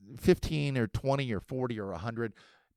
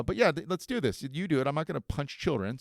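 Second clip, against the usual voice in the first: ordinary talk, nothing marked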